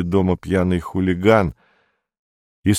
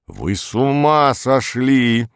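slow, drawn-out exasperation; irritated; 4 semitones higher, slower than usual. A narrator's reading. Secretary scolds Perets at the wrong door, irritated. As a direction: stretch it out, raised and annoyed. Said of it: "сказала раздражённая секретарша".

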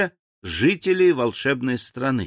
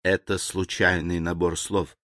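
low, soft, composed servant; calm reply; 6 semitones lower, 5 dB quieter than usual. A narrator's reading. Servant explains the machine fault to his master, calm. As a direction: explain quietly and evenly, pitched down. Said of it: "спокойно ответил слуга".

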